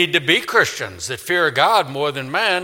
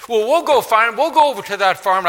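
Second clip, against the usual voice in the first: put-on higher, louder child voice; eager kids; 9 semitones higher, 5 dB louder than usual. Kids begging their father, higher child voice.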